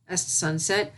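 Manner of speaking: ordinary delivery, nothing marked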